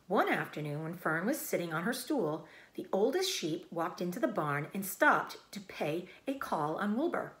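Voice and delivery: usual speaking voice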